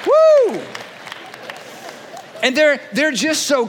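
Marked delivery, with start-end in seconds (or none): 0.1-0.6 high, loud whoop; excited whoop. Real, not acted. Whooping at the congregation after the preacher joke, high and loud. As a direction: let out a high, loud cheer.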